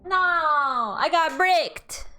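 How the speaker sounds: high, drawn-out wail of frustration; whining complaint. Real, not acted. Whining after being killed in game.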